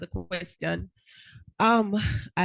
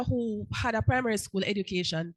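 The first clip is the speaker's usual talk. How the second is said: ordinary talk, nothing marked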